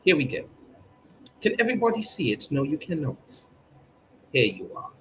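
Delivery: ordinary delivery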